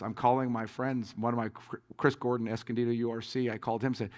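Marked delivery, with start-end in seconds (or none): none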